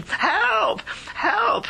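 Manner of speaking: put-on trapped boy calling up for rescue; shouting for help. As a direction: scream for help from the flooding shaft. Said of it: "he was shouting now".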